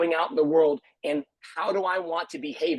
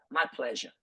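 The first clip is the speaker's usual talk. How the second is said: lightly imitated New Englander, a bit lower and softer; bad New England accent; 4 semitones lower, 5 dB quieter than usual. Quoting a kind mentor's reply, bad New England accent. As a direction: have a half-hearted go at a New England accent. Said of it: "here's my bad, uh, uh, New Englander accent".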